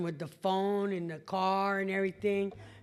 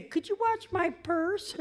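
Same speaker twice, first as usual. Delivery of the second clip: mock naive woman; 9 semitones higher than usual. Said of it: "stupid women would say".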